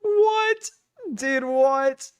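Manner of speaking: sudden high, shocked what; incredulous squeak of what; stunned what. Real, not acted. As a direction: blurt it out in disbelief. Shocked reaction to running mom over.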